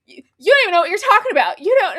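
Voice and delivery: high, loud, stammered mock protest; reenacting someone caught out, spluttering quickly; flustered denial. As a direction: blurt a fast defensive denial.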